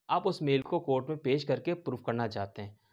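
normal talking voice

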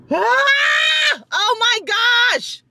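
high outraged yell; frustrated shout. Real, not acted. Vlogger shouting over the Publix bill, high frustrated yell. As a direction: let out a real frustrated cry. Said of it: "I'm so annoyed".

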